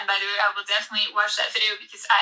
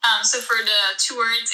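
normal talking voice

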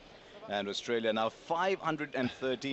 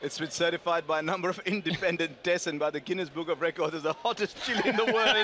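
laughing while reading; 6 semitones higher, 4 dB louder than usual. Real, not acted. Reads chili facts while laughing.

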